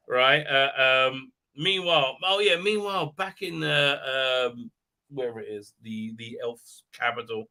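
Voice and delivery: ordinary talk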